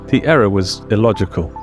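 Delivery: ordinary delivery, nothing marked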